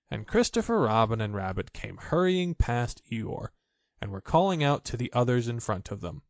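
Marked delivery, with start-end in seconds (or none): none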